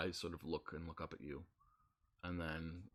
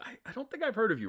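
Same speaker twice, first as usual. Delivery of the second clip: high, fast flustered stammer; struggling goblin clerk; 7 dB louder, faster than usual. Acted. Goblin clerk panics at unknown noble, high and fast. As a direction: squeak it out, caught off guard. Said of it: "you can see her struggling".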